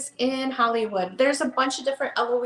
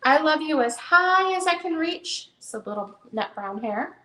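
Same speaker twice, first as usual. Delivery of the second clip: gentle storybook read-aloud; reading to children; 4 semitones higher than usual. Acted. Seller reads the hare book to viewers, storytelling voice. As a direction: read it like bedtime for a little one.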